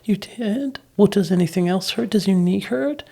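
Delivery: imitating a mother's high baby-talk questions; loving concern. Wife fussing over toddler's bumps, loving concern. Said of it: "was loving and reaching out".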